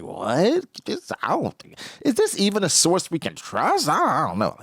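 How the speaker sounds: doubtful Wang Ji. Retold or imitated.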